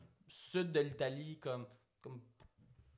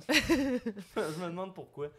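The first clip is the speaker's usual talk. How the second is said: slow, laughing, louder sarcastic take; 6 semitones higher, 4 dB louder, much slower than usual. Shown as a demonstration. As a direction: drag it out with irony. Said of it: "second take, laughing, slowed".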